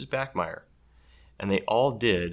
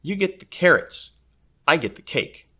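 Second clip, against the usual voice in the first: put-on bossy girl; 8 semitones higher, 8 dB louder than usual. Bossy girl divides lunch.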